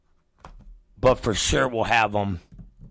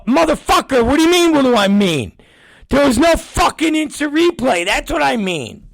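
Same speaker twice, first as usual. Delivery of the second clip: much higher, louder furious yell; retold angry yell; 6 dB louder than usual. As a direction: explode at the producer, retelling it. Retold blowup at producer.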